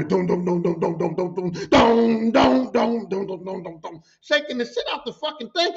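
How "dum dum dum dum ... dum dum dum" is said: pounding "dum dum" chant, swelling louder then easing; Ultimate Warrior imitation. Imitating a coworker shaking the machine, pounding wrestler-style chant. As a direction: chant it in heavy, driving beats. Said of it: "He's like Ultimate Warrior".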